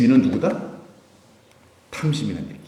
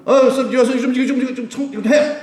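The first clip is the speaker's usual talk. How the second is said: mock bossy congregant, high-pitched; imitating someone impatiently giving orders; 10 semitones higher, faster than usual. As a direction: boss the pastor around in a raised voice. Congregant ordering the pastor about.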